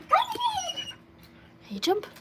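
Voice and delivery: very high, very slow stretched coax; coaxing sing-song. Real, not acted. Owner hypes her dog up for a jump. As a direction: drag the can way up high to hype her.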